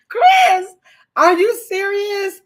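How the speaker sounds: high, loud, disbelieving; can't believe it, slow and loud; incredulous. Real, not acted. Incredulous after laughing.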